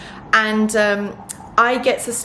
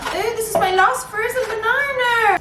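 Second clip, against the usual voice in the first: high, surprised oh; sudden high note of surprise; 12 semitones higher than usual. Real, not acted. Notices last banana.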